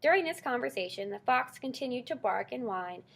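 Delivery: plain reading voice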